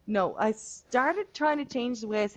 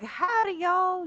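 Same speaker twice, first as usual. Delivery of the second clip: much higher, hammed-up Southern drawl greeting; cartoon Southern howdy; 12 semitones higher, slower than usual. Shown as a demonstration. Irish gamer mocking a Southern accent to a friend, hammed-up Southern howdy. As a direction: overdo a cowboy Southern accent. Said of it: "I didn't wanna, like, start out like".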